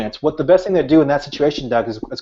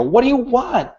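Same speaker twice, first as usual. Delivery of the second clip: high-pitched angry retold yell; a retold angry yell at his daughter; 10 semitones higher than usual. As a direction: snap it at her like a furious dad. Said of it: "screaming top of my lungs".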